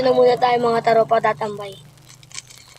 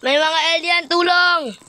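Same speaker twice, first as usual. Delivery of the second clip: high alarmed call for help; scared cry for help; 6 semitones higher, slower than usual. Acted. Sees aliens, calls for help.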